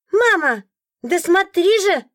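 put-on high, slow child's call tugging at mum; a little boy pestering his mother to look; excited child. A narrator's reading. Little boy urging his mother to look, high child voice. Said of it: "фразу ребёнка".

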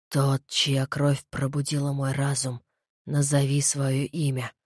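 low, slow voice of a dead head; undead voice. Acted. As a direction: speak like a corpse waking up. Undead head speaks from beyond.

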